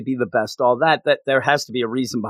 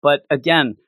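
ordinary delivery

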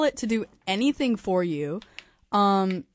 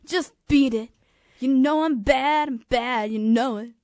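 higher, louder pop star impression; punchy, drawn-out lyric-quoting comeback; 3 semitones higher, 4 dB louder, slower than usual. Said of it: "can I be [name]?".

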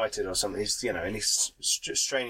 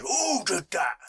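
orc voice; 7 semitones higher, slower than usual. Acted. GM voices orc noticing attack.

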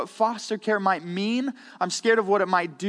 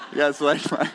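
ordinary talk, nothing marked

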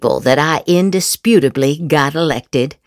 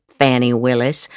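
normal talking voice

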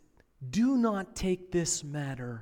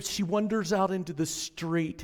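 normal talking voice

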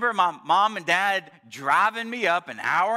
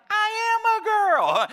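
high-pitched mock protest as the wife; indignant wife; 13 semitones higher than usual. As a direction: squeal it back indignantly, imitating her. Wife retorts to teasing husband.

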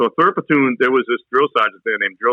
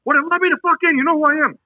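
drill sergeant yell; faster than usual. Retold or imitated. Drill sergeant yelling at recruit.

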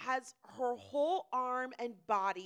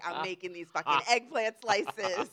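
loud mock tirade rising to a shout; comic angry rant; 11 dB louder than usual. Imagined grilling lady hating the storyteller, mock fury.

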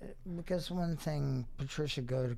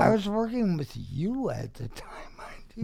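teasing whisper; 6 semitones higher, 7 dB louder than usual. Real, not acted.

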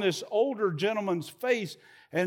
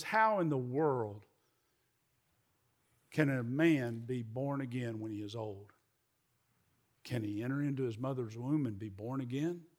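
bewildered Nicodemus; 6 semitones lower, 6 dB quieter than usual. Acted. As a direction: ask it like a confused elderly man, hushed. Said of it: "he looks back at him and says".

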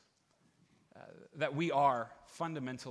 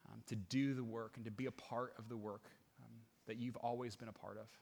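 softer, unhurried prayer voice; congregational prayer; 4 semitones lower, 7 dB quieter than usual. Acted. Pastor praying to God before a new subject, quiet prayer. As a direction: pray quietly, letting each request settle before the next.

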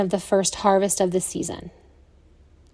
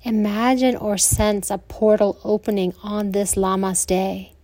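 soothing Yoga Nidra guidance with long pauses; guided meditation. Acted.

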